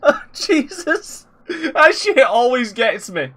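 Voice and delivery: high and slow, wheezing with laughter; laughing. Real, not acted. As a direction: barely get the words out for laughing. Host laughing at replayed clip.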